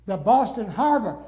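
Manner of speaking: mock exasperated call; insistent repeat. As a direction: raise your voice so the cabbie gets it. Repeating louder to cab driver.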